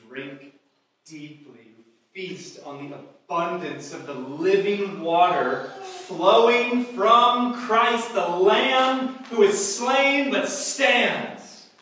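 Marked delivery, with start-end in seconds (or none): 1.8-11.7 slow, loud, drawn-out pulpit proclamation; preaching at full force. Acted. Preacher proclaiming Christ the slain Lamb, slow and loud. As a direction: stretch each word out over the congregation.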